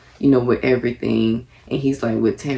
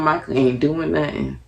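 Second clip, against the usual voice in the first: ordinary talk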